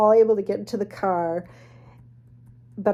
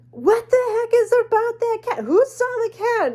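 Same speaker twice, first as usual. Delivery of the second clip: panicked; 6 dB louder than usual. Retold or imitated. Friend panicking about cat.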